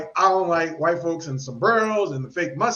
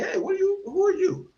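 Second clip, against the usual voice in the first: challenging a stranger; 11 semitones higher than usual. Retold or imitated. Challenging an outsider.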